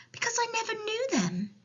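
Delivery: put-on high-pitched little girl voice; small, high child's reply; Sophie. A narrator's reading. Sophie answers the giant.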